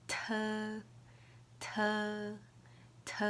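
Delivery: crisp aspirated "T", repeated; letter sound demo. Shown as a demonstration. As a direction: model "T" with a puff of air. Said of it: "the T represents this alphabet".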